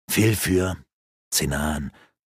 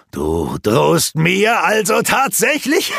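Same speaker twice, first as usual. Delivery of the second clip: higher, slow, scornful disbelief; amused mockery; 8 semitones higher, 4 dB louder, slower than usual. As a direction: savour the threat as a joke. Rurak mocking.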